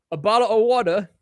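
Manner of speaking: lightly hammed British accent on the phrase; mock British accent. Streamer teasing British viewers in a put-on British accent. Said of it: "this is British".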